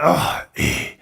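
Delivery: put-on a weak, slow Scottish accent; lightly put-on Scottish accent, worn out; bad Scottish accent. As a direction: slur it tired in a failing Scottish accent. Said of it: "I'm trying to do a Scottish accent".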